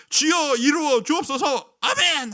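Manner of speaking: slow, loud, high pleading prayer; fervent prayer. Retold or imitated. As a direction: beg God for the miracle, drawn out. Retold earnest childhood prayer.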